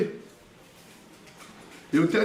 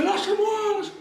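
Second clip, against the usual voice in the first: retold yelling; 13 semitones higher, faster than usual. Father pinned on the floor by relatives, high yell. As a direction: yell at them to let you go.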